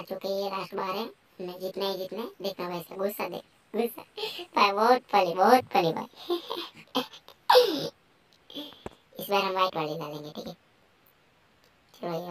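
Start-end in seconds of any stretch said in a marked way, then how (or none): 4.6-6.4 loud and drawn out, cracking up; loud amused. Real, not acted. Gamer cracking up at the angry character, loud and amused. Laughing at the game. 6.5-7.9 high laughter. Real, not acted. Laughing at the game.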